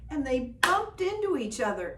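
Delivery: ordinary delivery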